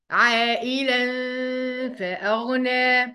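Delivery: "عائلا" stretched, nasal hum before "فأغنى"; madd and ikhfa. Shown as a demonstration. Teacher answering how it is said, "عائلا" long with ghunna. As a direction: chant "عائلا فأغنى" slowly with hidden nun. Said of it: "يبقى هنقول إيه؟".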